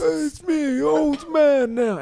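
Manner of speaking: put-on high, croaky old man voice. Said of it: "at least he did".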